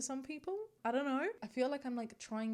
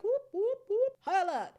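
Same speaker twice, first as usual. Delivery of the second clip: high, loud whooping alarm; hammed-up alert siren, shouted high; alarm siren imitation; 12 semitones higher, 12 dB louder than usual. Host acting out her racism detector going off, mock alarm. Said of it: "isn't going off and being like".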